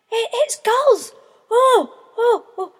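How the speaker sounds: high, loud breathless joy; excited chick. A narrator's reading. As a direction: gasp with delight at the gulls. Chick excited seeing gulls.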